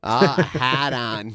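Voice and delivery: slow Boston accent, no R sounds. Shown as a demonstration. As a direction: stretch it out like a Bostonian. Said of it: "thick Boston accent".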